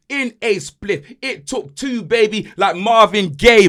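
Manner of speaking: ordinary talk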